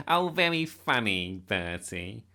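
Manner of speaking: loud sarcastic sneer. A narrator's reading. Nick scorning Bertie's claim about the Queen, sneering. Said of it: "sneered Nick".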